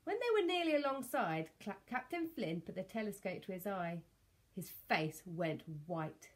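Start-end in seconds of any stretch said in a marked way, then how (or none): none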